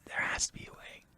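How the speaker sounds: breathy whisper to himself. Real, not acted. Friend pondering gender debate, hushed whisper. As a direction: murmur it like a private hope.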